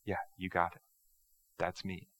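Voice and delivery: soft, understated reply; quiet assurance. Acted. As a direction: answer calmly, dropping the volume. Voices Jesus's calm answer to objectors.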